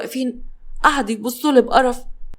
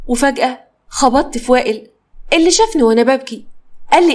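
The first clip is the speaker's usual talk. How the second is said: plain reading voice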